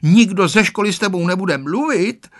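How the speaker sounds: high-pitched schoolgirl threat; threatening. A narrator's reading. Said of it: "hrozila mu Tereska".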